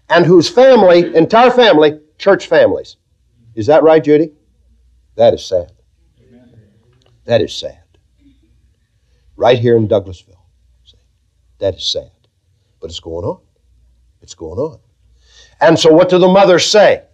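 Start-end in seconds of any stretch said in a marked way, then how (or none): 5.1-14.8 sorrowful. Real, not acted. Lamenting teen pill use.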